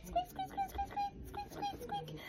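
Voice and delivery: tiny squeaks like a toy rat; puppet rat squeaks. Pretend.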